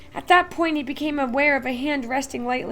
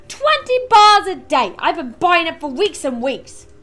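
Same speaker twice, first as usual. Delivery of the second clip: frustrated shout; 5 semitones higher, 11 dB louder than usual. Acted. Frustrated crowd member.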